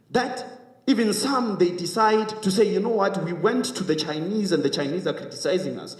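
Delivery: high-pitched, urgent public speech; rousing speech with insistent rhetorical questions; impassioned oratory. Real, not acted. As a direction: press each rhetorical question on the audience.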